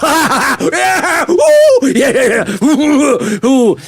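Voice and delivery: gleeful whoops, laughing; mock celebration. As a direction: cheer like your team just won. Mock celebration of Wales winning.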